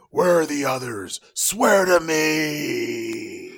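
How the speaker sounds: loud raspy growl, dragged out; Bale Batman voice. Retold or imitated. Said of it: "Bale was like".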